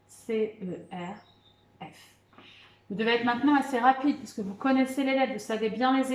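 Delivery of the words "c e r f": very slow, letters spaced wide apart; very slow spelling. Shown as a demonstration. Teacher checking the spelling of cerf with pupils, very slowly. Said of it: "On vérifie".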